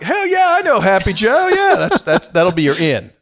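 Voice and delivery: hyped local. Retold or imitated. Jackson County local hears the name, excited whoop. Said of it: "They'll go like".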